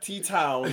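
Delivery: Teton said as "T-Town"; "T-Town", both parts fully sounded; wrong pronunciation. Shown as a demonstration. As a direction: end "T-Town" like the word town. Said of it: "It's like, no, not".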